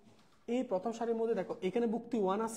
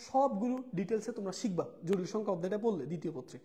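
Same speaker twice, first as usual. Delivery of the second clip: ordinary talk, nothing marked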